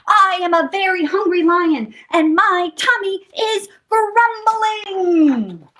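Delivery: high, wailing outburst; yelling lion. A narrator's reading. Lion protests his hungry tummy, yelling. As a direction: yell it out, dragging the ending long. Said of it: "yelled the lion".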